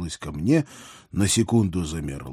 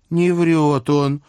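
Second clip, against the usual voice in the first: put-on slow, lightly raised girlish voice; young princess voice; 7 semitones higher, 5 dB louder, much slower than usual. A narrator's reading. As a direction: defend him sweetly. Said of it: "вступилась за меня".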